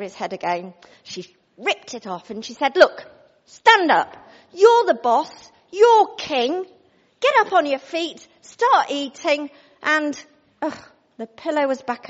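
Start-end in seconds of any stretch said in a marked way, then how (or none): 2.8-9.5 loud, slow commanding queen; bossy queen. Acted. Jezebel ordering Ahab out of bed. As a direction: order him up like a drill sergeant.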